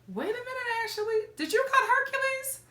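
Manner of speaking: high, sudden disbelief; wait, what?; surprised. Real, not acted. Shocked at breakup news.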